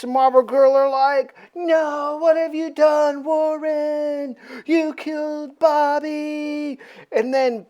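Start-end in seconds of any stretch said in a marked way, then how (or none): 1.5-6.8 high, drawn-out mock wail; hammed-up anguish, stretching the words; mock dismay. Teammates confronting Warren over killing Bobby, mock-anguished.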